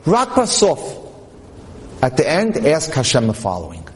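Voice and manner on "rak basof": high, insistent; teacherly emphasis; emphatic. Real, not acted. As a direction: press the point hard. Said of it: "here's what I want you to do".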